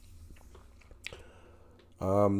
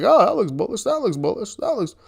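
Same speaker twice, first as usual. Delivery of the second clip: higher, softer delighted browsing voice; light put-on admiration, pitched up; mock casual enthusiasm; 6 semitones higher, 3 dB quieter than usual. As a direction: imitate cooing over one find after another. Imitating himself browsing stock symbols.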